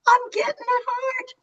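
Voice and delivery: real tears catching the words; tearful. Real, not acted. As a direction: choke up, overwhelmed. Moved to tears on screen.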